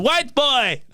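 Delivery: a hint of a commanding old Nigerian grandma; bossy grandma. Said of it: "She was going".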